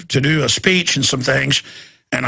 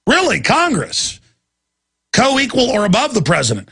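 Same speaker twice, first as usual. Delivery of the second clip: higher-pitched, incredulous rhetorical question; 5 semitones higher than usual. Real, not acted. Host challenging Congress over being kept in the dark, incredulous. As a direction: draw it out in disbelief, pitched up.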